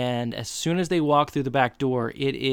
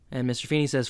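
ordinary talk, nothing marked

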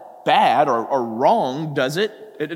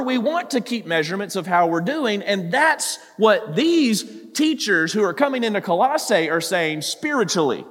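ordinary delivery

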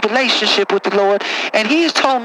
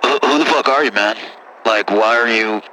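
put-on much lower, rough-talking good ol' boy; deep caller voice; 11 semitones lower than usual. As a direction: play a low-voiced country caller. Same caller voice.

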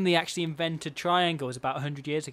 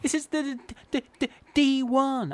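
high, stammering jingle impression; Woody Allen; 9 semitones higher than usual. Co-host imagining a celebrity jingle singer, in stammering impression. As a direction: stutter the jingle in a put-on high voice. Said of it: "Was that your Woody Allen voice?".

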